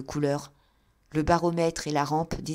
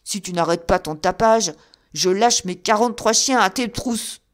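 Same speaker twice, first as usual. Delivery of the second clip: loud threat; 3 semitones higher, 8 dB louder than usual. A narrator's reading. Old man threatening the knocker with his dogs, loud shout. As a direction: yell the threat down at him.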